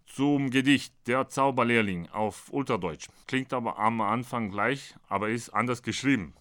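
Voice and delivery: ordinary delivery, nothing marked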